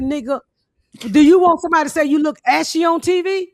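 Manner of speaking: high, loud scolding; furious retold scolding; indignant shout. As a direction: yell it at the makeup artist. Scolding makeup artist.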